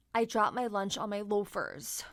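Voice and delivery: usual speaking voice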